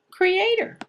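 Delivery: normal talking voice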